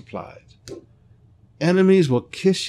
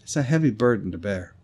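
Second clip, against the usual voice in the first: ordinary delivery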